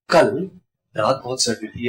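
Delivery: कल set apart by a pause, the rest low and soft; single out कल as the surprise; stress on कल. Shown as a demonstration. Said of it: "surprising है कि कल बहुत सर्दी थी". (कल पर ज़ोर देकर)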